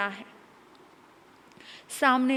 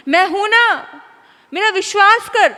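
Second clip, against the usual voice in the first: loud assurance; 8 semitones higher, 11 dB louder than usual. Acted. Father's loud false assurance.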